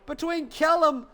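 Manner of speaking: rising to a high, fast call; excited commentary. Real, not acted. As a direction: build the call as contact happens. Overtake and contact, rising excitement.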